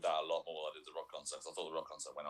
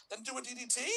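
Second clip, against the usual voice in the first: squeaky high imitation of a wrestler; mimicking an accidentally high-pitched call; really high pitch. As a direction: blurt it way up high, rising like a question. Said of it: "suddenly going at really high pitch".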